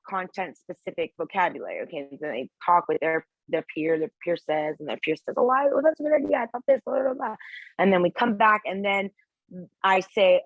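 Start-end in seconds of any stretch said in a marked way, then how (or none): 5.4-7.3 quick, higher-pitched student chatter; peer's reply. Acted. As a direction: rattle it off like a chatting classmate. Acts out a peer replying.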